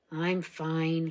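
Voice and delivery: understated gruff reply; grumbling. A narrator's reading. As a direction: brush off the help with a mild grumble. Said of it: "he grumbled".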